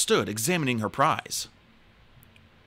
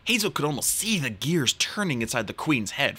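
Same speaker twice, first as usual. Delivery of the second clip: ordinary delivery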